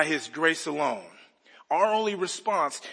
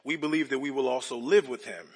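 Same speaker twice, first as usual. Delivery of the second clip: measured, lower solemn reading of the text; scripture reading; 4 semitones lower than usual. Acted. Pastor reading Romans to the congregation, solemn and measured. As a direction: read the passage with reverent weight.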